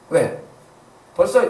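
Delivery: ordinary delivery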